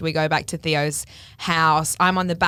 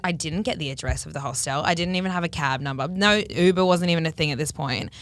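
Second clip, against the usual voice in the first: normal talking voice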